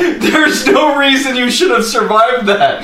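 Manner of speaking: high, amazed, laughing; can't believe it, laugh it out; laughing disbelief. Real, not acted. Teasing a friend who somehow survived, laughing disbelief.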